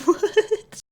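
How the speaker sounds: high, loud laughing disbelief; incredulous, pitch jumping up; baffled. Real, not acted. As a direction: burst out in amused bafflement. Baffled by the absurd question.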